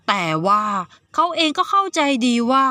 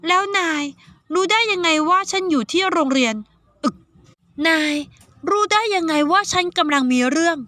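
put-on higher young girl's voice, puzzled; 7 semitones higher than usual. Saiyaka questioning the boy, in a higher girl's voice. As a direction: voice Saiyaka as a suspicious teenage girl.

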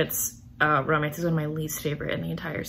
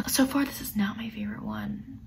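whisper; 5 dB quieter than usual. Real, not acted. Whispered confession.

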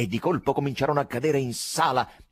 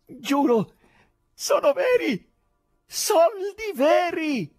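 high, slow, drawn-out shouts of glee; joyful shouting; 4 dB louder, much slower than usual. A narrator's reading. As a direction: yell with delight at the money. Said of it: "gridavano gioiosamente".

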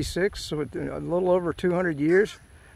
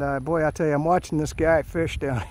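ordinary talk, nothing marked

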